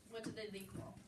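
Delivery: ordinary delivery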